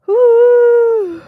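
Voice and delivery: excited cheering whoop; woo shout. Real, not acted. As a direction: belt out a whoop.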